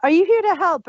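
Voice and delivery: normal talking voice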